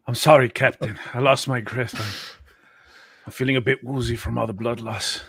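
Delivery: usual speaking voice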